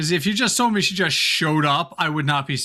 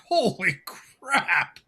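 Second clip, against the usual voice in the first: long drawn-out stunned exclamation; shocked; 4 semitones higher, much slower than usual. Retold or imitated. Recounting a huge sponsorship figure, shocked and drawn out. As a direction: stretch it out slowly in amazement. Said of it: "I was shocked".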